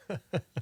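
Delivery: a quick burst of laughter; short laugh. Real, not acted. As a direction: laugh it off lightly. Laughs at burning boots.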